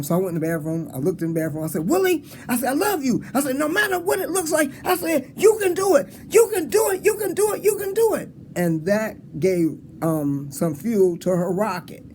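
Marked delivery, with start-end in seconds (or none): 1.9-8.3 hyped self pep talk. Retold or imitated. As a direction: pump yourself up in the mirror. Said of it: "when she heard me do that".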